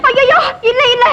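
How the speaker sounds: high, wailing denial; panicked denial. Acted. Woman denies she is his wife, panicked. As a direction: deny it in alarm.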